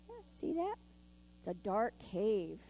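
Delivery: usual speaking voice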